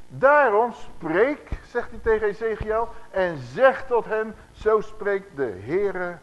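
slow, loud, weighty reading of scripture; solemn scripture reading. Acted. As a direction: declaim each word like a prophet. Reads scripture.

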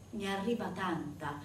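normal talking voice

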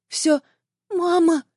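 put-on high, whimpering child's voice; sobbing little boy. A narrator's reading. Crying son answers his mother, high tearful voice. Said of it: "всхлипнул он".

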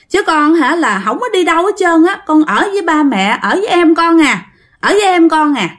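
put-on very high child's voice refusing; stubborn little girl. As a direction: refuse like a small girl shaking her head. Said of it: "chị lắc đầu". (giọng bé gái rất cao, nằng nặc từ chối)

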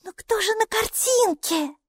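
very high, slow questioning voice; high character voice. Acted. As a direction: ask in a tiny, high-pitched character voice. Same high Майки voice asks.

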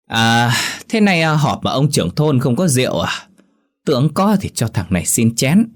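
put-on high, slurring drunk voice; drunken slur. A narrator's reading. Drunk villager asking the village head for wine, slurred and high. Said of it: "Nói xong, nó cười lên hành hạch".

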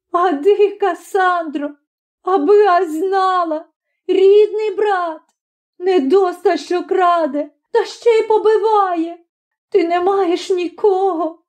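high-pitched tearful complaint; plaintive, wailing grievance; sobbing. A narrator's reading. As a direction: lament through sobs, voice very high. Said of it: "схлипуючи".

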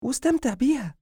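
high-pitched wife voice, encouraging; wife character. Acted. Wife telling her husband to enjoy the pregnancy, high encouraging voice.

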